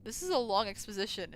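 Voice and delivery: ordinary talk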